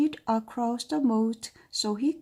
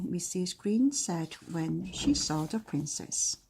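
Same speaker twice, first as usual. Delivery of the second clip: usual speaking voice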